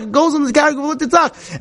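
normal talking voice

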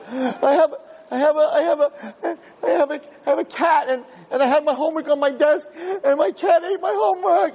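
put-on high child's voice, whining on the edge of tears; whimpering little kid. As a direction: stammer out the excuse like a scared kid about to cry. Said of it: "He starts crying".

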